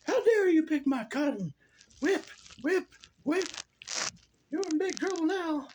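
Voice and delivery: mock angry master. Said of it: "He's like".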